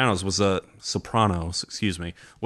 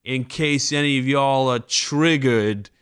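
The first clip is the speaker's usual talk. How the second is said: high, slow sneering comedian; hammy stand-up delivery; hack comic voice; 5 semitones higher, slower than usual. Retold or imitated. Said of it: "It's like".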